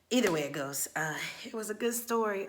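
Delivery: normal talking voice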